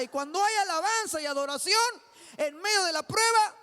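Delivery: loud, high, driving sermon voice; full-force preaching. Real, not acted. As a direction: hammer each exhortation at the congregation. (prédica enérgica, fuerte y aguda)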